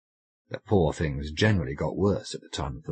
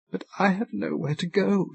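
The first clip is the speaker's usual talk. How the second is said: put-on a high, pleading cry of a lost girl; lightly voiced cry of despair; cried out; 14 semitones higher than usual. A narrator's reading. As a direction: call it out higher in distress. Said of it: "she cried".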